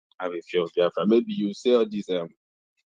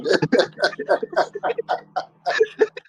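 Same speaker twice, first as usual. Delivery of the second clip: loud high burst of laughter; big laugh; 4 dB louder than usual. Real, not acted. Laughing at the guy who said he's Edo, loud high laugh.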